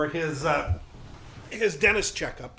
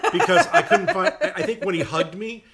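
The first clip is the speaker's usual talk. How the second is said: laughing through the words; cracking up telling it; 4 semitones higher, 8 dB louder than usual. Real, not acted.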